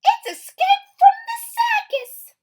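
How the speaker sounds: very high, loud squeaky mum; Missus Blair. Acted. Missus Blair finding her cereal eaten, very high and loud. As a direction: squeal it out in outrage. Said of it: "said Missus Blair".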